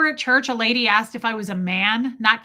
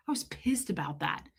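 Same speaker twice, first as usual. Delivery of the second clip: hushed confessional whisper; 4 semitones lower, 9 dB quieter than usual. Real, not acted. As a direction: whisper it as an aside. Whispered aside.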